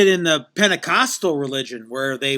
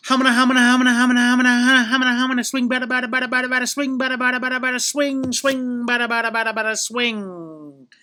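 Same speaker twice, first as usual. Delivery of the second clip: high, loud rhythmic gibberish chant; mock speaking in tongues; 9 semitones higher, 5 dB louder than usual. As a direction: chant the nonsense like ecstatic glossolalia. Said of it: "They speak in tongues".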